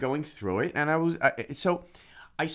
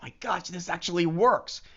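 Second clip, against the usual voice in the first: amazed; 6 semitones higher than usual. Retold or imitated. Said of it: "it's gonna be".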